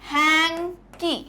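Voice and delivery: हैंकी drawn out in two parts, HAN, then KEE; broken into syllables. Shown as a demonstration. Teacher shows students a two-syllable word, broken into syllables. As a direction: say हैंकी slowly, one syllable at a time. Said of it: "हैंकी को मैंने कैसे बोला".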